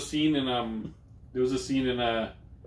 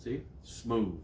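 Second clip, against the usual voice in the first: smooth deep voice; 4 semitones lower, 7 dB quieter, slower than usual. Pretend. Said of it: "my voice is like velvet and peanut butter".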